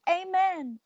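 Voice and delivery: high-pitched church amen, no real volume; mild narrated yell. A narrator's reading. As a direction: call it out high, like dad in the congregation. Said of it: "My daddy yelled".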